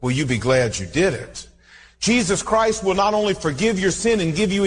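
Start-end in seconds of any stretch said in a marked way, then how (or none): 0.0-1.5 tender plea. Real, not acted. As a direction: plead gently, heart to heart. Oh, my friend.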